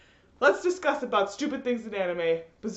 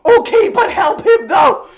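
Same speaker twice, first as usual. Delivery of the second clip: slow, much louder, higher exasperated demand; exasperated yell; 8 semitones higher, 14 dB louder, slower than usual. Retold or imitated. Viewer yells at the show, slow and loud exasperation. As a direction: drag it out loud at the screen. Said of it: "for me to be like".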